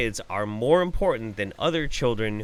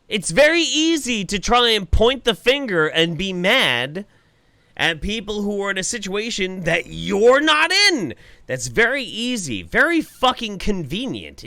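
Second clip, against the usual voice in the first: higher and louder, biting sarcasm; angry rant; 8 semitones higher, 4 dB louder than usual. Real, not acted. Streamer ranting at the nurse's clip, scornful anger. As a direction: tear into her, scornful and heated.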